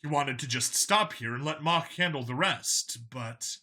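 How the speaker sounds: ordinary delivery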